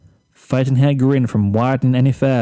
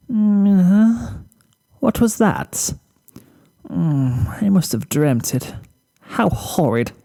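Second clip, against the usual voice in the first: much higher, sleepy posh mare waking; groggy Rarity; 7 semitones higher, slower than usual. Acted. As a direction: mumble half asleep, then dismiss it. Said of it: "Rarity groaned from inside the boutique".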